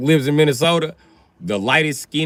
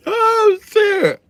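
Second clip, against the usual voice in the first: much higher and louder exclamation; surprised yelp; 6 dB louder than usual. Real, not acted. Reacting to the crowd, surprised and loud. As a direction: yelp it in shock.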